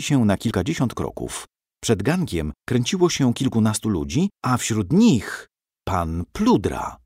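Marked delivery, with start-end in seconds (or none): none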